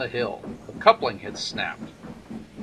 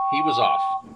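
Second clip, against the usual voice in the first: plain reading voice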